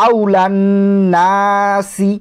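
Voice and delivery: আউলান নাসি chanted, with a whistled s in সি; whistling s recitation. Shown as a demonstration. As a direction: hold the n, then hiss the s of নাসি. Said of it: "শীষ করে পড়া বলে".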